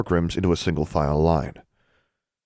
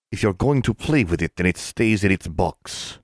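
lightly voiced old shopkeeper; a hint of a grumpy old man; gruff old wandmaker. A narrator's reading. Finsterholtz voice.

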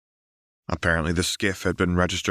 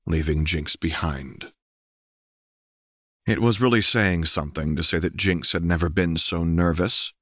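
plain reading voice

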